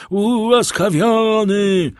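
high, drawn-out loud cry; crowd's shout. A narrator's reading. People at the execution echo the pardon, shouting. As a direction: shout it as a crowd, stretching the word. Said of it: "zakrzyknął lud".

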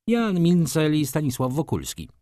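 a caught man groaning the answer; groaned. A narrator's reading. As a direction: groan the names out reluctantly. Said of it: "jęknął Maruszewicz".

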